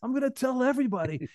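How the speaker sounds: high-pitched, giddy gushing; hammed-up squeaky delight; thrilled. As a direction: imitate a doctor beside himself with glee. Thrilled doctor acted out.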